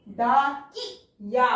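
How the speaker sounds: drawn out slowly, each syllable apart; slow sounding out. Shown as a demonstration. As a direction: sound out डाकिया for the children. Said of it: "teacher sounds out word".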